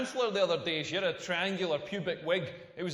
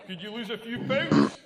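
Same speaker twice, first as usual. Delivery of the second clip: louder, laughing mock request; mock demand; 6 dB louder than usual. Comic asking partner to lose weight, laughing mock demand. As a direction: toss it out with a laugh. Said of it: "pumping away going like".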